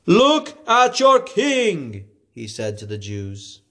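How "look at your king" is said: higher, very slow taunt; Pilate presenting. A narrator's reading. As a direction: drag out your king.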